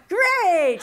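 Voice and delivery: high, booming one-word reaction; loud mock cheer. Daughter reacting to mother's razor answer, loud mock cheer. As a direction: burst out with a big comic "Great!".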